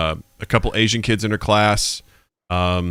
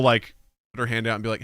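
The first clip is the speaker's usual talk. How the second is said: ordinary talk, nothing marked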